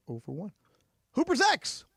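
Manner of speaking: ordinary talk, nothing marked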